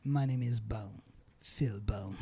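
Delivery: low, suave spy voice; James Bond voice. Pretend. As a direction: play the suave British spy. Spy-movie line, James Bond parody. (voix grave d'espion à la James Bond)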